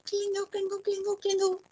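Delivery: high, level, chiming syllables; tinkling bells. A narrator's reading. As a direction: chime it like little silver bells. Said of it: "noise like little silver bells".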